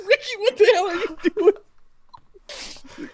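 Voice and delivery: high, strained, laughing as it comes out; mock-horrified plea to a friend; retold panicked disbelief. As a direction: squeak it in comic alarm.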